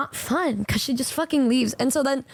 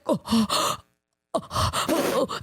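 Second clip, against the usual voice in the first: breathy repeated "oh" with gasps; mock overwhelmed gasping; shocked gasps; slower than usual. Retelling the shock at friend's answer, shocked gasps.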